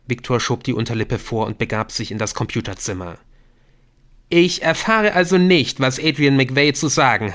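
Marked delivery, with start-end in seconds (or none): 4.3-7.3 indignant complaint. Acted. Viktor complains at being left out.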